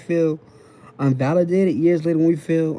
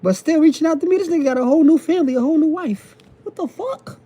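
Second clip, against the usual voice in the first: mock outraged confusion; 12 semitones higher than usual. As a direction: play the baffled ex, voice jumping high in disbelief. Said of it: "Y'all be like".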